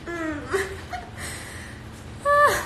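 breathy silent laugh. Real, not acted.

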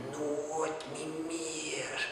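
very slow, soft raven voice; raven's answer. Acted. The raven refusing the poet, very slow and soft. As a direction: stretch each word like a tolling bell. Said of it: "Toen sprak die vogel".

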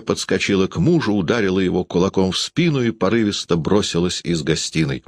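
usual speaking voice

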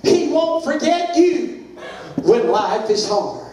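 high, sung-out preacher's chant; preaching cadence. Acted. Preacher chanting promises to the congregation, high sing-song. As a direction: lift each promise like a hymn.

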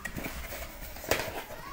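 long, soft, airy sigh; relaxed sigh. Pretend. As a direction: let out a long sigh of relief. Relaxing over the bath bombs.

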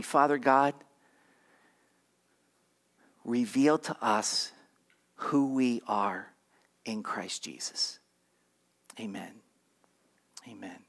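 low, slow prayer fading soft. Real, not acted. Pastor leading the congregation in prayer, low and slow.

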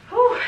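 loud high relieved exhale; relieved whew. Real, not acted. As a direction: blow out the effort hard. Blowing out after hard set.

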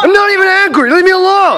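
high, loud furious yelling; angry denial. Acted. Johnny shouting at his friend to leave him alone, furious. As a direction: insist you're calm while shouting. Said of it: "Just calm".

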